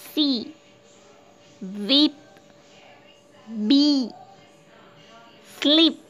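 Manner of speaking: सी, वीप, बी, स्लीप with a held "ee"; long "ee". Shown as a demonstration. Teacher demonstrating double E, सी to स्लीप with long "ee". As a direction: draw out the vowel in सी, वीप, बी, स्लीप, one word at a time. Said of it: "लंबा ई मतलब दीर्घ उच्चारण".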